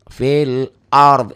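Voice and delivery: "ard" ends on a clear final d, no vowel after it; waqaf pronunciation. Shown as a demonstration. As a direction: stop on "ard" and land the d. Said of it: "dihentikan jadi fil ard". ("ard" dibaca mati di akhir)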